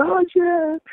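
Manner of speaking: high, drawn-out awestruck cry; retold giddy fan squeal; starstruck reaction. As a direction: relive meeting your hero. Retold reaction to their hero arriving.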